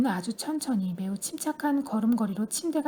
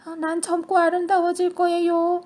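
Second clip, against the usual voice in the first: higher, louder joyful outburst; excited cry; 5 semitones higher, 5 dB louder than usual. A narrator's reading. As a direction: cry out with glee. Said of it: "소리쳤다".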